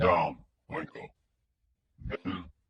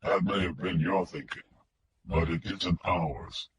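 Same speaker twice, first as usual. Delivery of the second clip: mechanical filtered voice; 3 semitones lower, 5 dB quieter than usual. A narrator's reading. Kidnapper on the phone to Wingo, mechanical filtered voice. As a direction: speak like a voice through a distortion filter. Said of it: "The filtered voice was mechanical".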